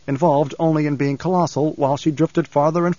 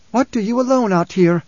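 a higher, sharper demand; a hint of a stern Fremen woman; 7 semitones higher, 3 dB louder than usual. A narrator's reading. Hara confronting Ghanima alone, lightly voiced demand.

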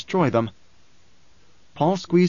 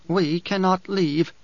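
higher character voice, measured and firm; Dory, firm woman; 5 semitones higher, slower than usual. Acted. Dory urges Paul to take the dwarf, firmly. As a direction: lift the voice for a resolute woman.

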